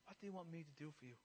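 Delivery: very soft, low, quick question; gentle question. Retold or imitated. Jesus asking the blind man his need, hushed question. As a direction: ask it softly, almost to yourself.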